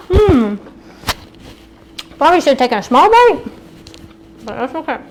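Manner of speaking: talking with a mouthful; chewing while talking; mouth full. Real, not acted. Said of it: "apologies for talking with my mouth".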